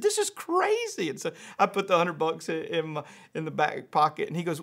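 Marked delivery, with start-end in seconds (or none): 0.0-1.1 very high, slow incredulous exclamation; amazed disbelief. Retold or imitated. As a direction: imitate an astonished kid, stretching the words. Retold son's amazement.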